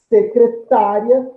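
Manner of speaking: 'Secretária' said slowly, stress on 'tá', final 'ria' glides in one pulse; teacher's careful 'Secretária', ending 'ria' kept as one sound; slow syllable demo. Shown as a demonstration. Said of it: "eu tenho esse A num só impulso".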